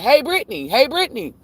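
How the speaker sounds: put-on high, singsong repeated kid call; pestering kid. Telling the stepkid who keeps interrupting, mimicking her high pestering. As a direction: mimic the child's nagging back at her. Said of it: "somebody keeps saying".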